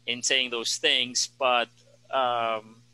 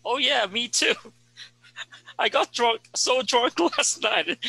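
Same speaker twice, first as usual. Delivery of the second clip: high, giggly bragging student; giddy student; 10 semitones higher than usual. Retold or imitated. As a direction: brag about partying with giggles. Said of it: "other students might actually say".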